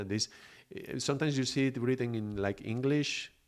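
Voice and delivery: ordinary delivery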